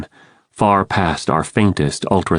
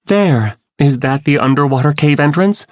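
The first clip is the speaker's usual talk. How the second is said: raised, excited question; a hopeful call; eager cry; 7 semitones higher than usual. A narrator's reading. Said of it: "Cassie cried".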